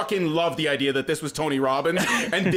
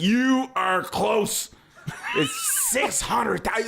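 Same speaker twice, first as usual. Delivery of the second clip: slow, heavily drawn-out words; hammed-up, laboured reveal; mock exasperation; 4 semitones higher, much slower than usual. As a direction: stretch each word like an exasperated drunk. Retelling drunk man's exasperated answer.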